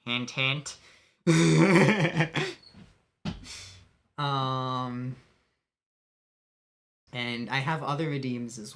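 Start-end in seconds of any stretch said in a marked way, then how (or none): 1.2-2.5 cheeky laugh; loud giggle. Real, not acted. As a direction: laugh at your own hint. Laughs after the hint.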